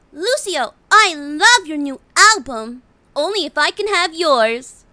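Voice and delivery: put-on high cute teasing girl; playful gamer girl voice. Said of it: "She said".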